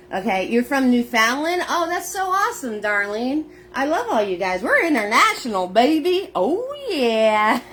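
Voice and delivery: delighted, cheering the viewers on; excited. Real, not acted. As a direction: hype up the international crowd. Thrilled by viewers' locations.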